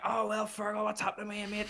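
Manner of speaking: high-pitched mocking imitation of a chummy punter; mimicked customer. Customer cracking jokes at the barman, mimicked. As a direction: put on a high, over-familiar greeting.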